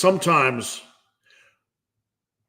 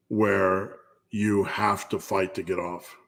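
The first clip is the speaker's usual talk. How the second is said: ordinary delivery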